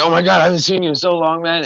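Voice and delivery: fast, high, overjoyed greeting; fake joyful reunion. Greeting a total stranger as an old friend, fast and gushing. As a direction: pretend you've missed them for years. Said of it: "be like".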